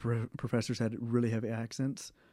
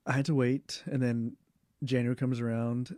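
usual speaking voice

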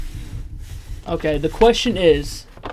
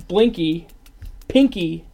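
ordinary talk, nothing marked

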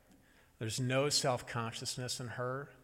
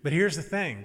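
louder, higher parent laying down terms; emphatic condition; 4 semitones higher, 7 dB louder than usual. Retold or imitated. Mother tells toddler the rule of the game, emphatic. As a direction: imitate a mother making the catch clear.

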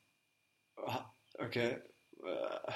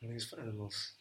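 very soft repeat; quiet thinking aloud; pondering to himself; 14 dB quieter, faster than usual. Real, not acted. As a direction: turn it over under your breath. Guesser repeats quote to himself.

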